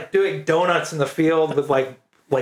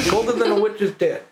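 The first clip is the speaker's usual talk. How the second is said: higher mock Maine delivery of "ass"; Maine saying; 7 semitones higher than usual. Recalling dad's cold-weather sayings, a higher mock Maine lilt. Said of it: "it'd be like".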